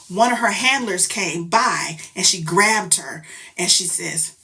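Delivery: ordinary delivery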